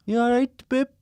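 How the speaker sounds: high, bright Welsh You all right, babe; Welsh accent. Shown as a demonstration. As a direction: sing it up in Welsh. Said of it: "babe in a Welsh accent".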